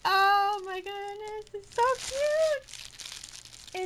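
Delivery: high, drawn-out delighted gush; real excitement. Real, not acted. Vlogger opening a gift from family, really excited. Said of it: "I'm really excited".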